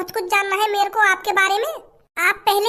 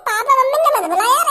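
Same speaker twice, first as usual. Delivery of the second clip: ordinary talk, nothing marked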